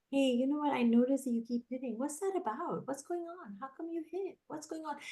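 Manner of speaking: put-on one-on-one talk with a calm child, gently; gentle calm questions. Shown as a demonstration. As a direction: ask kindly, like a caring friend. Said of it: "have the calm conversation".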